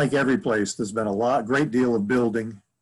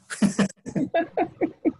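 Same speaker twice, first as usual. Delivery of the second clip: high laugh. Real, not acted. Laughs after long answer.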